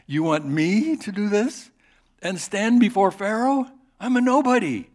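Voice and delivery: high, loud, disbelieving protest with a laugh; incredulous Moses. Acted. Moses protests to God, high and incredulous. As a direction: Moses can't believe he's chosen.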